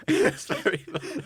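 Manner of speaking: said through laughter; real laugh breaking in. Real, not acted. Laughing at the pitch.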